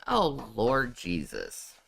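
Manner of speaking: ordinary delivery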